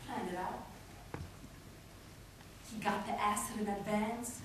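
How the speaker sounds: low, soft, chilling understatement; hushed and ominous. Acted. Poet revealing the planned acid attack, hushed. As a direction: drop right down after the outburst. Cold planning after the outburst.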